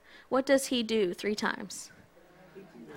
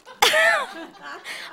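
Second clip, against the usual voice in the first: a sudden high giggle at his own joke; high burst of laughter. Real, not acted.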